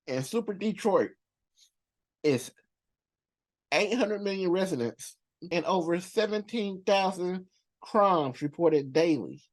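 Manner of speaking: ordinary delivery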